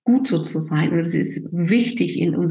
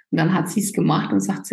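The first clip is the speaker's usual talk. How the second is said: ordinary talk, nothing marked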